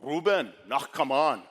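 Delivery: put-on loud Schwarzenegger-style Austrian accent; Austrian coach. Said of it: "sounds like Arnold Schwarzenegger".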